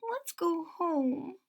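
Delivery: high, slow, soft pleading; soft mew. A narrator's reading. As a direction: mew it softly like a scared kitten. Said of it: "mews Sarah softly".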